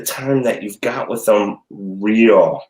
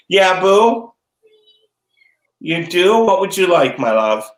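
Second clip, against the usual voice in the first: sweet to daughter; 8 semitones higher, 4 dB louder than usual. Real, not acted. Mom answering her daughter on the show, doting and high.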